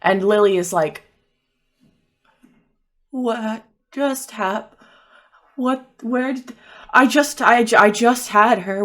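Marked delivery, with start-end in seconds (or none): 3.1-8.8 acted shock, from faltering to frantic; stunned disbelief. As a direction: stammer in shock, building towards an outburst. Character's friend vanished before her eyes.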